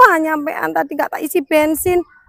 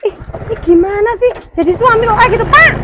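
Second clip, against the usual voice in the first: exasperated; 7 semitones higher, 5 dB louder than usual. Acted. Wife scolds rude husband.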